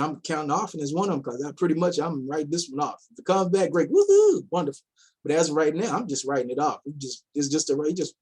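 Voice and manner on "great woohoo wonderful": mock cheer. Real, not acted. If the platform comes back, mock woohoo. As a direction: throw out a sarcastic little cheer.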